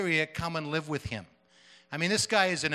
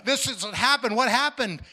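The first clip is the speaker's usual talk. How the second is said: high, loud breathless asking; excited questioning; 7 semitones higher, 5 dB louder than usual. Retold or imitated. Woman at the ministry center, excitedly asking what happened. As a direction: she bursts in wanting answers.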